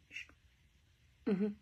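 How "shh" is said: soft shush to silence husband. Retold or imitated. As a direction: hush him with a quick shh. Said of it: "a mi esposo les decía".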